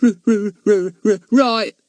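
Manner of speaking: high, stuck on the F, over and over; stammering. Acted. Fatty pretending to be scared before Goon, stammering. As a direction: fake a terrified stammer. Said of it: "said Fatty".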